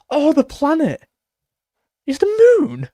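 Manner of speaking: loud, high-pitched amazed outburst; gasp, then cry out as it dawns on you; sudden realisation. Real, not acted. Streamer finally getting the moon joke, astonished realisation.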